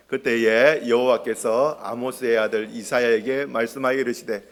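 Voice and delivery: low, slow, measured recitation; scripture reading. Acted. Preacher leading the congregation in Isaiah, measured reading. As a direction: read the verse out slowly for all to follow.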